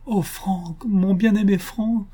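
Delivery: high, fervent lover's declaration; impassioned love. A narrator's reading. Heroine in her fiancé's arms, impassioned.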